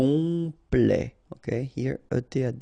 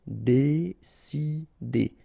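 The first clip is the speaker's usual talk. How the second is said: very slow "décider", dé-ci-der; syllable by syllable; much slower than usual. Shown as a demonstration. French teacher modelling for learners, "décider" slowly syllable by syllable. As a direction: pause between each syllable of "décider".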